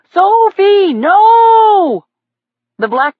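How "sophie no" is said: high, loud call to stop; yelling a command. A narrator's reading. Cyclist yelling at his puppy to stop, loud. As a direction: bellow it at the dog. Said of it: "he yelled".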